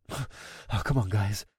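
soft, breathy frantic mutter. Acted. Searching for her missing friends, frantic mutter. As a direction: mutter it anxiously under breath. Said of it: "I muttered frantically".

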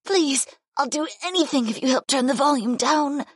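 high female character begging; high-pitched distressed bargaining; pleading. A narrator's reading. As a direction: beg desperately in a high voice. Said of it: "Keela pleaded with me".

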